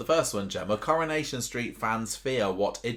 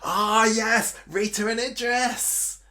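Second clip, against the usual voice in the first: excited realisation; 12 semitones higher, 5 dB louder, much slower than usual. Real, not acted. Delighted by pairing idea.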